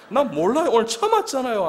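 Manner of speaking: high, loud retold comeback; mock protest. First-timer defends himself to the colleague, hammed-up protest. Protests when scolded about eating.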